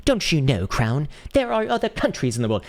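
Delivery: high, mock posh British accent. Streamer redoing the scolding about other countries, posh British voice. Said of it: "like a posh British voice".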